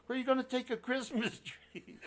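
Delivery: high, incredulous mocking question. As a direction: scoff at the tree idea. Cousin mocking the tree.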